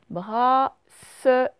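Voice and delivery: bracelet drawn out very slowly; slow dictation. Shown as a demonstration. Teacher sounding out bracelet to show the S. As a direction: linger on each syllable of bracelet. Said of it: "Le son se dans la syllabe".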